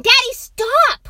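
put-on high, loud boy's protest; crying out. A narrator's reading. Boy cries out to stop father, exasperated. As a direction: shout it out like an exasperated child. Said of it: "cried the boy".

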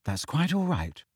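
understated, composed dignity; dignified. A narrator's reading. Hermione accepting Harry's apology, dignified. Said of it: "said Hermione with dignity".

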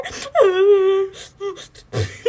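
loud, high fake sob; hammed-up burst of crying for the story; mock sobbing. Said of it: "That's me sobbing, guys".

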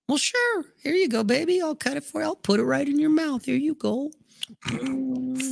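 hammed-up doting boyfriend feeding her a bite; mock sugary baby talk. Feeding girlfriend bite of steak.

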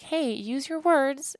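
put-on mother lightly correcting her toddler; gentle parental correction. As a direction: coax a toddler to speak. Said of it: "And I was like".

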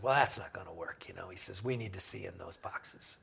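a soft, unvoiced whisper for the officer; hushed officer. The officer refusing to let the roadies go, whispered. Officer quoted at night stop.

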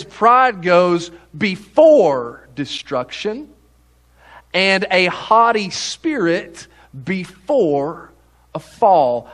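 slow reading, BEFORE drawn out and punched; stress on before. Real, not acted. Preacher reading a proverb to the congregation, heavy stress on before. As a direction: lean hard and long on each "before". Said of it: "I put emphasis on the word before".

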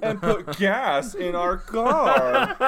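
mock American accent. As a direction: stretch the American accent absurdly. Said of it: "I'm an American".

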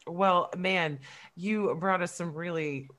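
ordinary talk, nothing marked

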